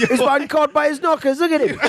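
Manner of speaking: mock British jeer. Hosts mocking the burglar in British accents. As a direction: cackle at him like a London local. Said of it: "that accent is still so fun to me".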